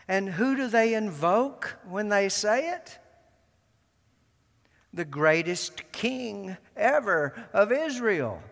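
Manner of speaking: ordinary talk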